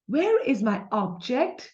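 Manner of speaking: "object" as a noun, OB stressed; slow question, punch the first syllable of "object". Shown as a demonstration. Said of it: "ob पे हमारा stress आ रहा है".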